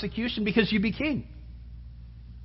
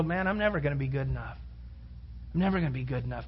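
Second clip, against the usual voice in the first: lower, much softer, dejected mutter; defeated mutter; 9 semitones lower, 10 dB quieter, much faster than usual. Retold or imitated. Churchgoer walking away from a judgmental believer, softly defeated. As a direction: mumble it to yourself, beaten down. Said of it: "you kinda walk away and go".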